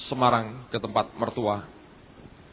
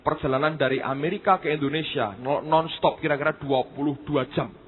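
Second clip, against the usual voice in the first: usual speaking voice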